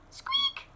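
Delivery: mouse squeak. Acted. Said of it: "said Mouse".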